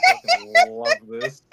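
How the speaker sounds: laughing with delight. Real, not acted. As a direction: laugh through it.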